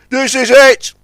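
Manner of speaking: high and loud with effort; excited. A narrator's reading. The crane braces as the line pulls tight, straining. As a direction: cry out as the line goes tight.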